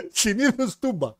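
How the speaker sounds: mock drawn-out echo. Teasing back the answer about brothels, mock drawn out. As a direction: repeat the answer like a cheeky boast.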